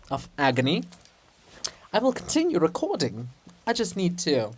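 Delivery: ordinary delivery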